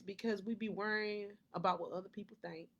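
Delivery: ordinary talk, nothing marked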